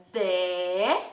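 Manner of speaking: "De" held long on its own, low then rising; isolated syllable. Shown as a demonstration. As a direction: stretch "De" as one separate part of a word. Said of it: "Falando parte por parte".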